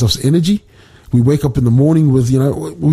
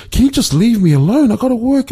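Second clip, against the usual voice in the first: high-pitched mock exasperation; furious father; 10 semitones higher than usual. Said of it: "the father's furious by this time".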